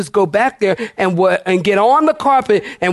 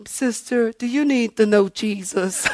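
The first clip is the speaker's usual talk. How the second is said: lightly voiced soft church lady; softer, careful mock-concerned question; gentle approach; 2 semitones higher, 5 dB quieter than usual. Church stranger asks a visitor about Jesus, gently.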